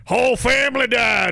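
hammed-up Old West drunkard; pissed-off drunk cowboy. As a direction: holler it like a drunk gunslinger. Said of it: "pissed off looking and drunk all the time".

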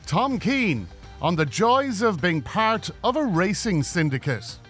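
upbeat announcer read over music; bright radio promo voice; podcast intro read. Acted.

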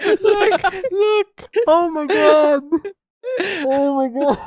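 high squealing laugh through words; gleefully reading gossip. Real, not acted. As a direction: lose it laughing in delight. Laughing fit over casting news.

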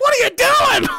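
high, loud startled shout; alarmed yell. Retold or imitated. Mom catches him pulling out stitches, alarmed yell.

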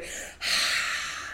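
long voiceless sigh letting the air out; demo breath out. Shown as a demonstration. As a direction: breathe it all out audibly. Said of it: "you go".

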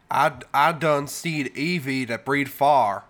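slow, thick Southern drawl; Southern accent. Retold or imitated. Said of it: "had a really thick Southern accent".

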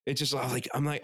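ordinary delivery

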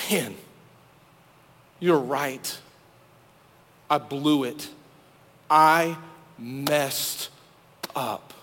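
quiet, broken admission to his friend; broken confession. Retold or imitated. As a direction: let the words crumble out in shame. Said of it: "David was absolutely broken".

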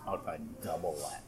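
quiet. Real, not acted. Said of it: "quietly between us".

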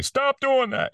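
high, sharp scolding yell; yelled order. Retold or imitated. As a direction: shout it down like a fed-up listener. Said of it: "somebody yells at you".

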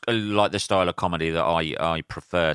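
ordinary delivery

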